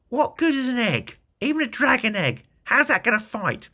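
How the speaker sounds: very high, squeaky goblin questions; a doubtful goblin squeaking its questions; third goblin. Acted. Third goblin questioning the leader in a squeaky high voice. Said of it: "asked the third goblin".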